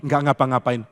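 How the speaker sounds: frightened bystander excusing himself to the bully; scared reply. Retold or imitated. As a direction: answer like you want to disappear. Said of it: "Orang langsung takut".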